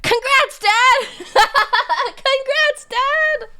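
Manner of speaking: high and loud congratulations; excited. Real, not acted. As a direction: cheer it out with a laugh.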